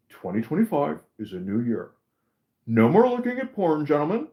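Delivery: lightly mimicked halting proclamation; Elon Musk. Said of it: "even Elon Musk a couple days ago, he's like".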